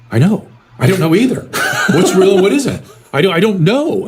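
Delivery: high, laughing half-admission; retold laughing confession. Patient laughing as he admits he is lost. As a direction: let the laugh break through the words. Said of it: "and he goes".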